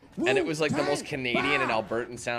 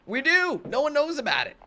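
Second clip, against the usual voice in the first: high-pitched indignant squeal; mock indignant protest; 14 semitones higher than usual. Canadian gamer protesting being left out, squeaky indignation. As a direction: protest in a high, hurt, put-on whine.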